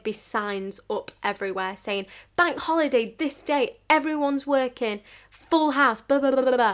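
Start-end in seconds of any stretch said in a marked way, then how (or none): 2.4-6.7 higher, louder sign-reading voice; mock announcement. Waitress reading out bank holiday staff signs, mock announcing voice. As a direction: reel it off like a hammed-up notice.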